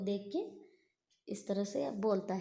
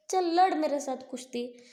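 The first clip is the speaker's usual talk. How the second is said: daring shout; 6 semitones higher, 5 dB louder than usual. Acted. Bhima dares demon to wrestle.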